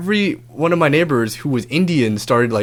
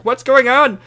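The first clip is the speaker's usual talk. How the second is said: high, loud call down from upstairs; shout from window; 11 semitones higher, 5 dB louder, slower than usual. Retold or imitated. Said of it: "shouting out of their windows".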